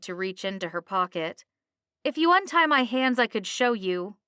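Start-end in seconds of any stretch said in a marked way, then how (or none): 2.0-4.2 high, loud start, easing off; indignant protest. A narrator's reading. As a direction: argue back loudly from high up. Bound woman protests to captain.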